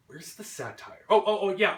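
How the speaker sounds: usual speaking voice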